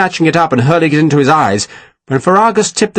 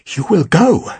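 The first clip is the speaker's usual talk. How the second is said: higher, rising, barely managed; strained plea; 5 semitones higher, slower than usual. A narrator's reading. Sarah repeating her order under threat, strained and rising. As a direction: force the words out, voice lifting. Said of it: "Sarah had managed to say".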